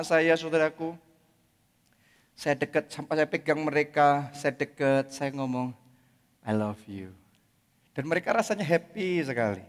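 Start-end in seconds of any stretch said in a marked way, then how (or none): 6.4-7.1 soft, low and tender; mean every word of it; gentle heartfelt I love you; from the heart. Shown as a demonstration. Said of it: "ngomongnya dengan hati".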